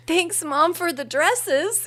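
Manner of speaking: giggling through a mock thank-you; high, laughing tease; teasing her friend as mom, through laughter. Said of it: "We just laughed so hard".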